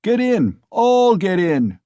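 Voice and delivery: loud, high shout, slowing at the end; crying out. Acted. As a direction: roar the invitation. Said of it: "cried Mikolka".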